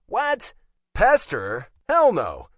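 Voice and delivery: loud, high outburst of disbelief; indignant shock. Acted. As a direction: deny it as if appalled. Indignant denial to his sister.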